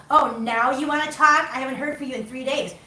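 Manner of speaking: mock an offended friend guilt-tripping; resentful friend. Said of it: "a lot of people would be like that".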